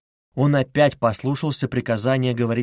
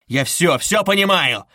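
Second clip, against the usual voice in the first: rising, raised-voice insistence; furious outburst; 5 semitones higher, 4 dB louder than usual. A narrator's reading. Suspect rushing up to investigator, furious outburst. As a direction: burst out, hammering it home.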